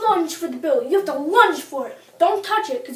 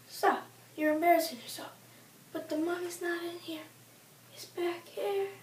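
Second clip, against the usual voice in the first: softer, murmured discreetly; quiet classy aside; 3 semitones higher, 9 dB quieter than usual. Acted. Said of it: "he'll say".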